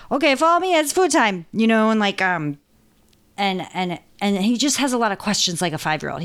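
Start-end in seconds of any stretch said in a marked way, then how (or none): 0.1-1.5 put-on high, bright little-boy voice, a bit louder. Owner voicing her cat Monty as a little boy, high and eager. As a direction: play a bossy little boy leading the way. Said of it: "little boy voice".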